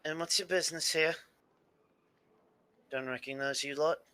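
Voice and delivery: guard at the door, lightly voiced; a hint of a wary guard questioning strangers; gruff guard. Guard questioning the party at the door, lightly wary.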